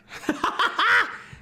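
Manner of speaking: laughing. Real, not acted. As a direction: burst of high laughter. Laughing after the prank.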